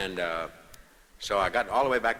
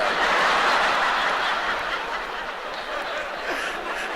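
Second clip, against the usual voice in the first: silent breathy giggle rising high; real giggle; high wheezy laugh; 4 dB louder than usual. Real, not acted. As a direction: break into a high wheezing cackle. Long laugh.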